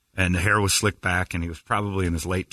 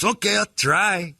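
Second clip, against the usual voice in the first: excited husband voice; 8 semitones higher than usual. Retold or imitated. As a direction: sound giddy to try it. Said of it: "he goes ... he's all excited".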